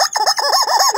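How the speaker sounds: loud squealing laugh; very high laugh. Real, not acted.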